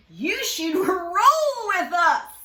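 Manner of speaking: slow, loud invitation; zebra voice. Acted. Zebras inviting Jim to roll, slow and loud. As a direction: coax him to roll.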